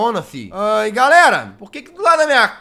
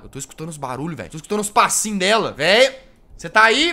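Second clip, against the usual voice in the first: ordinary delivery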